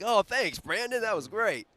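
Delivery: high, loud mock-grateful squeal after a sweaty hug; squeaky fake gratitude, hammed up; mock thanks. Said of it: "I'm like".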